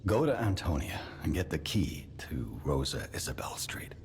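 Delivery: secretive whisper. Acted. Secret instruction.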